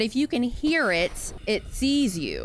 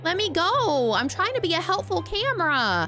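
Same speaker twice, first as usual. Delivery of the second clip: high, indignant squeal; high indignant shrieks; outraged disbelief; 12 semitones higher than usual. Real, not acted. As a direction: protest at the thieving fish. Stalkers steal her camera.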